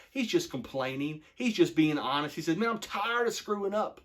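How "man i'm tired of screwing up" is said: exasperated. Retold or imitated. Paraphrasing Paul's complaint.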